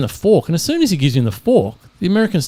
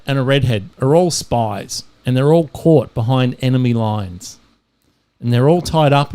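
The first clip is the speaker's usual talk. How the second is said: normal talking voice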